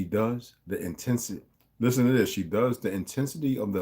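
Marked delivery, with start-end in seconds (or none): none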